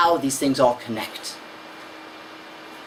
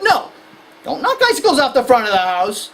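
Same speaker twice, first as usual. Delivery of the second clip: stern parent; 6 semitones higher, 4 dB louder than usual. Retold or imitated. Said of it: "concerned parent, go".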